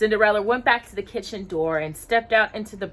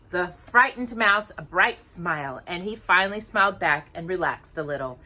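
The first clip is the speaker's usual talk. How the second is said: usual speaking voice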